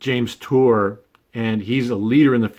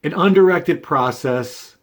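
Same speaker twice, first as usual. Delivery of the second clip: "undirected" pitched higher and pushed forward; emphasis on undirected; 4 semitones higher than usual. Real, not acted. Speaker restating the definition against the lay view, stress on "undirected". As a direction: make "undirected" the key word, raised and firm. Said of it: "emphasizing the word undirected process".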